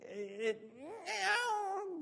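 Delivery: high, drawn-out, whining meow; cat meow. Pretend. Preacher showing the cat slipping back into meowing, high and drawn out. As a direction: stretch the meow out like a pitiful cat.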